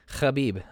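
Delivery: "Khabib" with a throaty, scraped KH; phlegmy KH. Shown as a demonstration. Host demonstrating Dagestani names, "Khabib" with phlegm in the KH. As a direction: start "Khabib" on a rasped back-of-throat fricative. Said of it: "with the phlegm".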